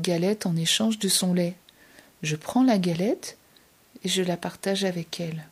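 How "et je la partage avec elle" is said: ordinary delivery, nothing marked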